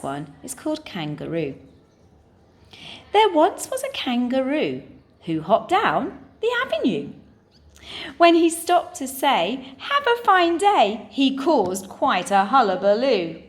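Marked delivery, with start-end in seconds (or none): 3.1-13.4 lilting, animated verse reading; limerick recital. Shown as a demonstration. Teacher reading a funny limerick to the class, animated recital. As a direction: recite with bouncy rhythm, lifting the quoted greeting high.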